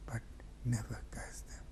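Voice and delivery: whispered, fading command; whispered plea. Acted. Hussein softly begging his sisters never to curse. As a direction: breathe the last words.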